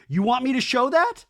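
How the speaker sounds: high, loud challenging question; retold challenge. Retold threat from Michelle.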